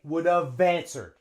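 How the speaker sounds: "would have answered" as "would-uv-vanswered"; linking. Shown as a demonstration. Teacher shows we link them, joined up. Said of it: "and we link them".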